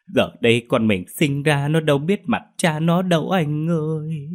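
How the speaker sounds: theatrical mock grief, sobbing cries to the dead husband; fake wailing lament. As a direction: wail over the coffin like a grieving widow putting on a show. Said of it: "Những câu than vãn não lòng".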